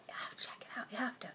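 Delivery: hushed urging whisper. Real, not acted. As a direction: whisper it like a secret tip. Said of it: "urging secretly".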